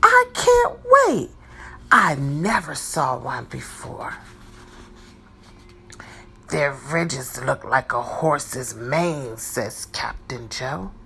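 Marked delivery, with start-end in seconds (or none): 0.0-1.3 high, loud, can't-wait squeal; eager excitement. Acted. Pete eager in story. 1.9-9.4 a hint of a lower, softer character voice; low, quiet storybook character line; lightly voiced character.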